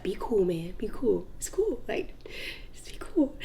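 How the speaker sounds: acted whisper to keep cool; soft, breath-only pep talk to yourself; hushed self-talk. Telling herself to calm down.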